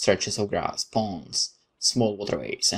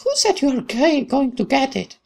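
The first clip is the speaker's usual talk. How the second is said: put-on much higher, bright girlish voice; cheerful young woman; 5 dB louder than usual. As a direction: play the friendly girl who sneaks up, chirpy.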